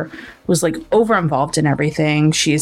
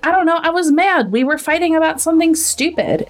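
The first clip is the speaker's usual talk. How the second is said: high-pitched, lightly acted excuse; mock defensive; 9 semitones higher than usual. Shelby explains away her frightened texts, mock defensive. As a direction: imitate someone brushing off a question, pitched up.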